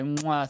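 kiss sound. Pretend. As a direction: blow a kiss into the mic. Blown kiss in sign-off.

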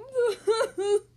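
high, loud mock sobbing; wordless hammed-up wailing; cartoon bawling; panicked wail. A narrator's reading. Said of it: "mewing at the top of their lungs".

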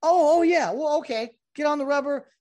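high, loud mock realization; suddenly remember the rule, hammed up; umpire catching on. Umpire realizes and tells the pitcher to get on, in a high loud mock voice. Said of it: "they're like".

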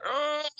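a sharp, very high "Oh!"; high mock wail at a sad movie scene; high cry of grief. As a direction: let it break out high, like being hit by the moment. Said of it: "I was like".

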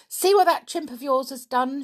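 high, loud angry scolding; cross scolding. A narrator's reading. Brown-faced woman shakes Nobby over his chimp, scolding.

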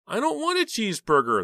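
put-on high, loud little-boy whine; whining little boy. A narrator's reading. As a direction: whine in protest like a small child. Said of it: "The little boy was whining loudly".

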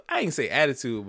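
ordinary delivery

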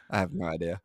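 ordinary talk

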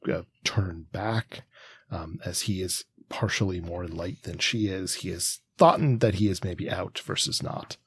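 ordinary delivery

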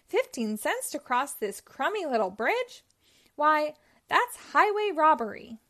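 outraged. Acted. Goat outraged at toll.

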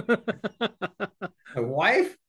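chuckling as he delivers the joke; laughing. Retold or imitated. Dad answers who runs the show, laughing.

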